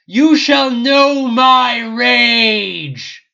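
dragon bellowing. Acted. The dragon watching its minions die, bellowing. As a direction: bellow in disbelief. Dragon threatens before fire.

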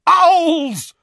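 huge, high furious bellow. A narrator's reading. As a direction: bellow the word at the window. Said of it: "bellowed Uncle Vernon".

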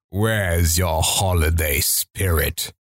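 low, slow menacing growl; brooding video game villain; Reaper voice. Retold or imitated. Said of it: "two voice lines for Reaper".

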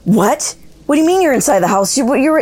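high-pitched, rushed mock panic; retold panic. Aunt on the phone hearing grandma is inside, panicking. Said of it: "just started panicking".

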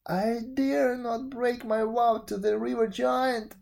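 high, tearful plea. A narrator's reading. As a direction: still crying, afraid of the vow. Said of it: "burst into tears".

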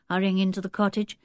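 usual speaking voice

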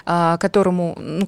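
ordinary talk, nothing marked

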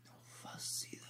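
unvoiced whisper under the breath; hushed confession; whispered aside. Real, not acted. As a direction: whisper it off to the side. Aside, no idea who she is.

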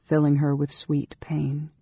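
ordinary delivery, nothing marked

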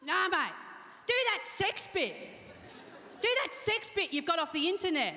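high mocking heckler voice; lightly voiced drunk heckler yelling out; a hint of a rowdy heckler. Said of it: "she's there going".

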